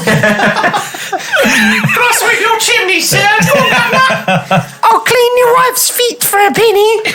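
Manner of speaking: high Cockney chimney sweep voice, laughing; hammed-up Victorian Cockney urchin; Cockney accent. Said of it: "But in the Cockney accent".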